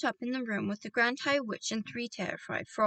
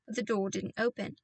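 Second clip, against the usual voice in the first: usual speaking voice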